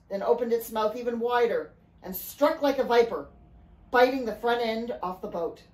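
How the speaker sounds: plain reading voice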